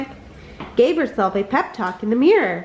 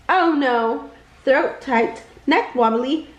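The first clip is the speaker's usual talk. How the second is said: ordinary delivery, nothing marked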